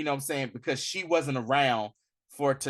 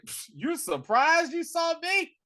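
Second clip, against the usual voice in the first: mock Killmonger sneer; slower than usual. Killmonger mocking Shuri.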